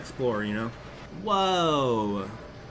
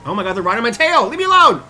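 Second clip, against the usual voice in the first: higher and much louder panicked shout; frantic yell; 9 semitones higher, 13 dB louder, faster than usual. Real, not acted. Chased by the cops, frantic shouting. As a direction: yell it, desperate to escape.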